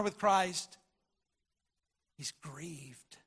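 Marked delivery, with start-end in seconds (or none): 2.2-3.2 hushed. Real, not acted. Preacher stresses Peter's grief.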